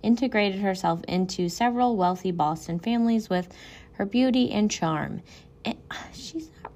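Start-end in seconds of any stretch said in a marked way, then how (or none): 5.6-6.7 hushed catty aside; whispering. Real, not acted. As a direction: whisper it behind your hand. Catty aside about her looks.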